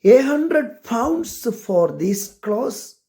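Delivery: a mildly angry exclamation, drawn out; exclaimed angrily. A narrator's reading. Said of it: "Betty exclaimed angrily".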